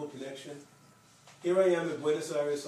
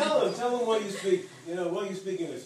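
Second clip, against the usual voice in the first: raised, louder insistent prodding; imitated urging; 4 semitones higher, 5 dB louder than usual. Student to Martin about his English, urging him. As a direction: press a reluctant friend to explain.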